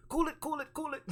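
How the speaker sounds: high, loud mock yelping; rapid high-pitched pleading imitation; frantic movie character. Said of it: "but he just comes out".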